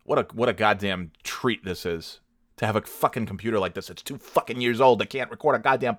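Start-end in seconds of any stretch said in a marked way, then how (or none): none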